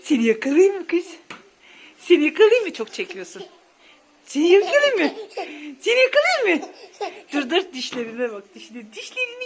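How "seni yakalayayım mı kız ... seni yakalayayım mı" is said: louder, higher gotcha game voice; excited mock-threatening coo; playful threat. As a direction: tease like you're about to pounce. Tickle chase game.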